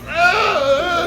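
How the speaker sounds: high, drawn-out growling cry; imitated bear roar.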